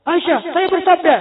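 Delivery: high, loud declaiming; mock pompous pronouncement. Husband imagined pronouncing to his wife, loud mock authority. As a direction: proclaim it at her with exaggerated authority.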